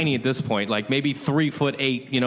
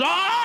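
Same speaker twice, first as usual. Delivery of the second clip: mock scream. Nephew imitating the great aunt's yelp as she's yanked up, mock scream. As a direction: squeal like a startled old woman. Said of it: "She starts screaming".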